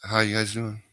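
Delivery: smooth radio DJ greeting. Pretend. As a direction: greet listeners like a radio host. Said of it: "I need to get my radio voice on".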